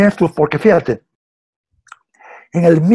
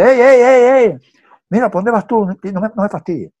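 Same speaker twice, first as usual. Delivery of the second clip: retold call-out; 6 semitones higher, 5 dB louder than usual. Man calls after the crying girl.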